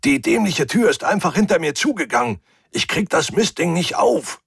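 high, strained call through a shut door; Hubert. A narrator's reading. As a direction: call out your annoyance from behind steel. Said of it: "hörte ich Hubert leise rufen". (genervt durch die Tür rufend, hoch)

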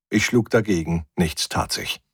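plain reading voice

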